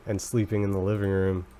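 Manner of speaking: ordinary talk, nothing marked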